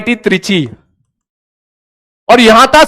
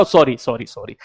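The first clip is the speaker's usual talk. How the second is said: sheepish fading sorry; 9 semitones lower, 9 dB quieter than usual. Retold or imitated. Embarrassed apology, fading.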